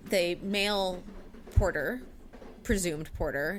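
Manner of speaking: ordinary delivery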